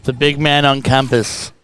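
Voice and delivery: loud ring-announcer roll call of nicknames; announcer send-off. Acted. Host saying goodbye to George for the audience, loud announcer voice. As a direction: hype him up loudly, title by title.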